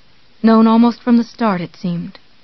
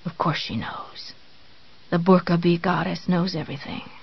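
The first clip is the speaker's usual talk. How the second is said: whisper; 3 semitones lower, 9 dB quieter than usual. A narrator's reading. Drugged inner thought.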